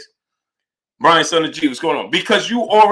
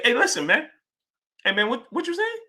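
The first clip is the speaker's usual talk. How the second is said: higher, softer, lightly voiced Earl; imagined Earl; 6 semitones higher, 6 dB quieter than usual. Streamer imagining Earl's reply to the callout, a light mock voice. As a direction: play Earl brushing it off, light and airy. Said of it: "Earl gonna say".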